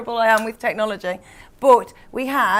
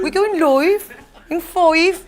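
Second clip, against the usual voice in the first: Bobby Davro mocking her accent; 6 semitones higher, 4 dB louder, slower than usual. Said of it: "accent ripped apart ... including by Bobby Davro".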